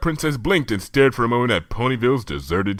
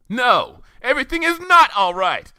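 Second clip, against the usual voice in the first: higher-pitched huffy whine; full snit; 4 dB louder than usual. A narrator's reading. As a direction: sulk it out in a huff. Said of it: "entering full snit mode".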